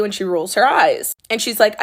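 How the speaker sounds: normal talking voice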